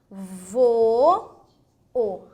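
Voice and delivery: vou and o split apart, each said on its own; syllable split. Shown as a demonstration. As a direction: give vou a full beat, then o separately. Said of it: "Quando você diz lá". (separando vou, o em sílabas)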